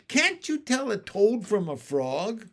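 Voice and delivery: high-pitched, exasperated question; scolding, astonished question; incredulous. Acted. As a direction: ask it in loud disbelief. Scolding the stranger in disbelief.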